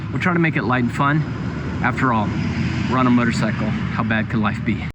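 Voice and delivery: usual speaking voice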